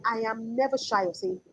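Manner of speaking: ordinary talk, nothing marked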